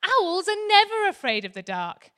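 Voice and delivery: high, loud mother owl voice; a mummy owl insisting her chick be brave; high, loud mummy owl, stress on never. Acted. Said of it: "his mummy said".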